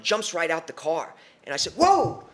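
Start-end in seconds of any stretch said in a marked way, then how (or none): 1.7-2.2 loud, high-pitched acted panic; alarmed shout. Teen being grabbed from the car, acted alarmed yelling. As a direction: cry out as if suddenly grabbed.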